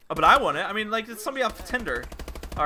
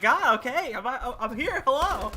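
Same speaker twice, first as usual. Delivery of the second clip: high-pitched put-upon reply; retold flustered protest; exasperated; 7 semitones higher, slower than usual. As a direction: give in with mock exasperation. Acts exasperated answer to yelling.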